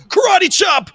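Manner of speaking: very high loud karate cry; karate chop yell. Retold or imitated. TSA agent striking the crotch, a high loud karate cry. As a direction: shout it like a martial arts strike.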